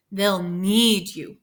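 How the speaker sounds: need punched loud and drawn out; stress on need. Shown as a demonstration. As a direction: lean hard on the word need. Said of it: "notice how I emphasized the word need".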